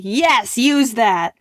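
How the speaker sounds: loud, emphatic cheer; eager approval. Retold or imitated. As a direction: urge him on enthusiastically. Acts her eager approval.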